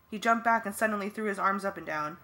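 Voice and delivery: ordinary delivery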